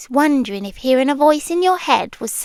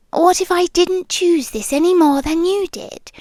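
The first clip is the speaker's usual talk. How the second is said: usual speaking voice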